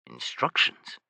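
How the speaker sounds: whispered, wary question; man answering his furious lover, quietly. A narrator's reading. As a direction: breathe the question softly. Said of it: "he asked quietly".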